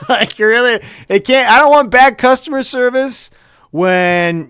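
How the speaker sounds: high, loud insistent burst; loud mock outrage; emphatic rant. Real, not acted. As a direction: push it up and out in protest.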